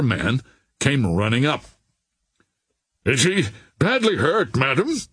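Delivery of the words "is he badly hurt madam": higher, urgent question; crying out. A narrator's reading. Captain asking after a wounded man, crying out. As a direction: ask it with a mild cry of concern. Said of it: "cried Captain Norris".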